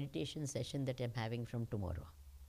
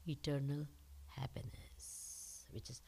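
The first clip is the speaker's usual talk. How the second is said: soft, slow, near-whispered truths; hushed meditative voice; 6 dB quieter, slower than usual. Acted. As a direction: draw them inward, hushed. Softens into a meditative teaching.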